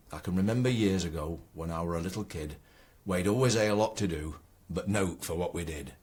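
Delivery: broad Ilkeston poem recital; Ilkeston dialect. Shown as a demonstration. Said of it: "written in the Ilkeston dialect".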